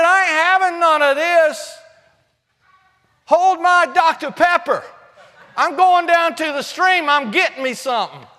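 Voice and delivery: fired-up, folksy defiance; defiant. Acted. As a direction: swagger like a cocky young challenger. Acts out David's defiant reply.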